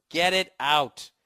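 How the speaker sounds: slow, measured repeat at normal pitch; insistent demand. Acted. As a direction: stretch each word out firmly. Repeats the plea more controlled.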